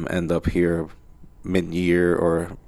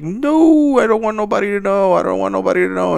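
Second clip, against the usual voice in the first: much higher, fast, pleading; frantic refusal; 12 semitones higher, 3 dB louder than usual. Retold or imitated. Honest guy frantically refusing publicity, high and fast. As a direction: rush out the plea to stay anonymous.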